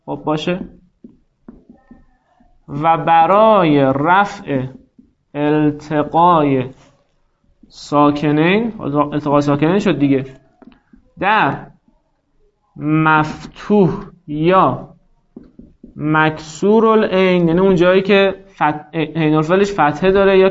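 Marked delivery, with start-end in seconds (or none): 2.7-8.7 very slow, word-by-word dictation with long pauses. Shown as a demonstration. Teacher dictating rule for writing. 11.2-17.5 very slow, word-by-word dictation with long pauses. Shown as a demonstration. Teacher dictating rule for writing.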